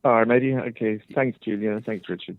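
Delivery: muffled voice over a phone call; muffled phone line. Real, not acted. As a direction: sound like a caller on a bad line. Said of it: "sorry if it was a bit muffled".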